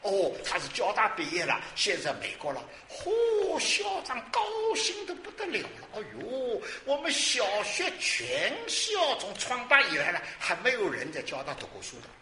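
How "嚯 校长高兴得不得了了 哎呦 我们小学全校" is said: high, drawn-out gleeful exclamation; 夸张的欣喜. Retold or imitated. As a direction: exclaim how thrilled someone was, pitched way up. Excitedly retells principal's joy. (兴高采烈地高声感叹)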